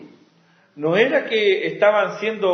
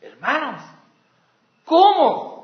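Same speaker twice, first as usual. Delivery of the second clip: higher, louder, bewildered appeal; pleading apostle; 7 semitones higher, 3 dB louder than usual. Retold or imitated. Paul pleading with the fickle Galatians, bewildered. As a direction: ask it as a hurt father would. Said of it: "lo que Pablo dice".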